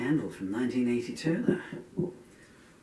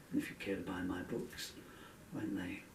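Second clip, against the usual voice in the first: choked up; 3 semitones lower, 9 dB quieter than usual. Real, not acted. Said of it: "I'm choking up a bit here".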